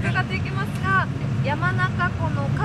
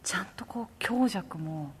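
hushed whisper over the music; 9 semitones lower, 12 dB quieter, much slower than usual. Real, not acted. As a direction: whisper so as not to disturb the piano. Whispering during piano performance. (演奏の邪魔をしないささやき声)

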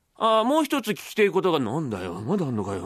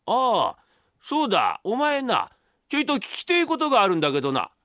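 higher voice calling upstairs; father remembering something, raised pitch; father of the story, inquiring; 4 semitones higher than usual. Acted. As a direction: call up with a sudden question.

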